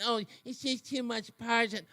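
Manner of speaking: sneering mimic of critics; sing-song mock complaint; mocking whine.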